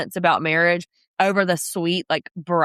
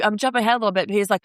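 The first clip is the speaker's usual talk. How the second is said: normal talking voice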